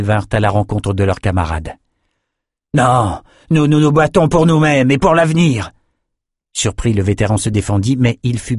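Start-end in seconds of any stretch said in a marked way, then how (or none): 2.7-5.7 defiant retort. Acted. Gladiators answer back in battle.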